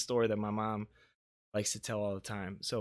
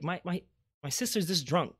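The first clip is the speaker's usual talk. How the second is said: ordinary talk, nothing marked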